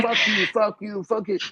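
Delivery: high-pitched mock cussing match; retold cursing. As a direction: imitate trading insults, no real anger. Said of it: "we're cursing each other".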